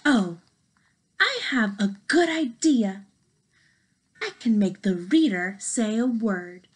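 usual speaking voice

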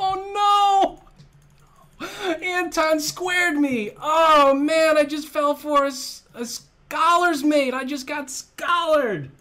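high, loud groaning complaint; loud dismay. Real, not acted. Streamer fell for scholar's mate, loud high dismay. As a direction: wail about being tricked.

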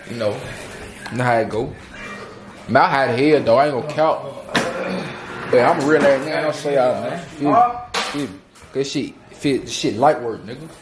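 normal talking voice

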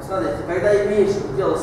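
ordinary delivery

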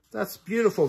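high, touched exclamation; thrilled by the valentine poem; delighted cry. Acted. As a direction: gush over the poem. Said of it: "she cried".

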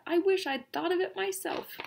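very high, excited squeal; excited shrieking. A narrator's reading. Nebraska admires Kansas's party idea, excited shrieking. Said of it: "shrieked Nebraska".

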